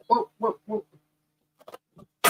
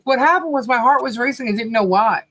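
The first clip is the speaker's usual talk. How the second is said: usual speaking voice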